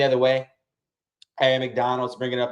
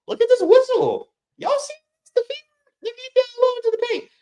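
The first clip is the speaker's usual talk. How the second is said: much higher falsetto, mocking the whistle; squeaky mock outrage; 4 dB louder than usual. Real, not acted. Streamer to chat about the refs' whistle, falsetto mockery.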